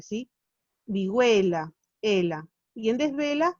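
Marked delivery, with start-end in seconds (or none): 0.9-1.7 Vihuela said alone, the E hit hard; stress on E. Shown as a demonstration. Teacher repeating the word to show its stress. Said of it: "suena fuerte".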